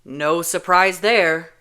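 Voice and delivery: slow sardonic snort; snorting sarcasm. Acted. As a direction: scoff at the obvious. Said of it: "Kenji snorts".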